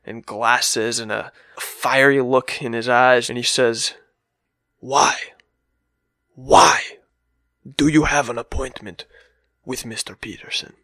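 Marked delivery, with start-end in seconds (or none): 4.8-10.7 intense German director. Retold or imitated. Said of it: "crazy-looking German man ... fiery look".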